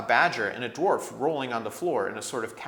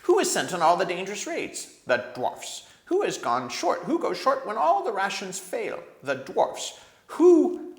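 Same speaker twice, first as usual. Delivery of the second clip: louder and louder, a bitter dwarf's grievance; an aggrieved dwarf working himself up, ending in a shrill yell; rising anger; 9 semitones higher, 8 dB louder than usual. A narrator's reading. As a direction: build from bitter to a shrill cry. Dwarf's rising angry complaint.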